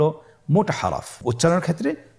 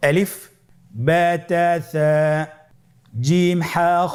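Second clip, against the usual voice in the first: recited letters; 4 semitones higher, slower than usual. Shown as a demonstration. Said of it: "আপনারা আমার সাথে বলুন".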